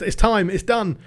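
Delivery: real celebration, shouting; jubilant shout. Real, not acted. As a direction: yell it out in triumph. Celebrating reaching the final.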